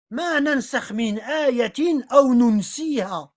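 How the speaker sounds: slow chanted Arabic recitation; Quran recitation. Shown as a demonstration. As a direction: recite the verse slowly, as in prayer. Citing a Quran verse. (récitation coranique lente)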